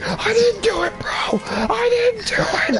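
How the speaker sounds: very high, crying out in a cartoon panic; panicked denial. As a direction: shriek your innocence while running away. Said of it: "he's like".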